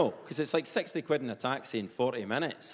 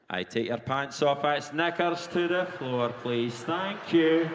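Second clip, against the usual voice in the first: measured public-announcement delivery; station announcer, drawn out, rising to a loud finish; train station announcer; 3 dB louder, slower than usual. Acted. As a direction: announce it like a railway tannoy. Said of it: "sounding like a train station announcer".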